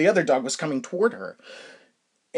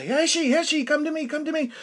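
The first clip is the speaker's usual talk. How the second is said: higher calling out to a runaway dog; owner calling dog; 12 semitones higher than usual. Retold or imitated. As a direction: call the dog back, pitched up. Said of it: "He says".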